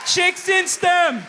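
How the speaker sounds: high, slow, loud yell; sudden shout. Real, not acted. Random crowd-style shout, high and slow. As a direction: belt it out oddly.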